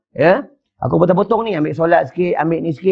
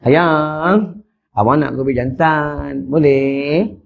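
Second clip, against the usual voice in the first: slow, low, sweet coaxing; gentle; 4 semitones lower, much slower than usual. Shown as a demonstration. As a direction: ask the wife tenderly, drawing words out. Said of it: "bila dua-dua lembut".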